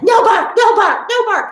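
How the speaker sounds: imitating a woman shouting high and loud at her dog; mock stern yell. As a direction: bark out the command, shrill and repeated.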